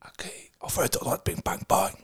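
quick hushed whisper. Acted. Kid whispering to siblings in bed. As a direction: rush it in a whisper.

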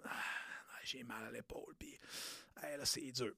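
breathy whispered whine about the shoulder; whispered complaint. Retold or imitated. Client whispering to his coach that his shoulder hurts.

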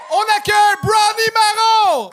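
loud, high stage introduction; ringing emcee shout over the room; announcer shout. Real, not acted. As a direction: call the act onto stage. Host shouts in next comedian.

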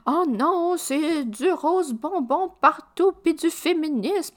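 mock the haters' dismay.

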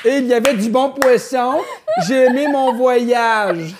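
put-on loud, high, slow old lady voice; imitating a grandmother. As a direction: speak up like to a deaf granny. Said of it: "On dirait que je parle à ma grand-mère".

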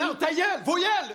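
high yelled shut up; angry shout. Acted. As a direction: snap at them loudly. Snapping at friends to shut up.

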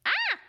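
loud, high-pitched "ah" of disbelief; shocked gasp. Acted. Reacting to KFC at Christmas, high shocked cry. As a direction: cry out in sudden mock horror.

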